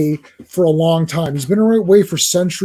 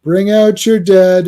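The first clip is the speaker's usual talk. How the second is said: slow, loud street cry; calling out; 2 semitones higher, 5 dB louder than usual. Acted. Fan quoting a film line as a joke, drawn-out calling. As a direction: stretch it out like a crier. Quotes a famous film call.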